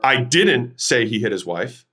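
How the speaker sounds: "didn't" punched and lifted, rest falls back; stress on DIDN'T. Shown as a demonstration. Coach showing emphasis to sales students, stress on DIDN'T. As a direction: hit the word "didn't", then finish evenly. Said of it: "then the emphasis".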